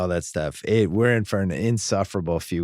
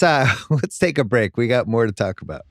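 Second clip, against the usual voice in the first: ordinary delivery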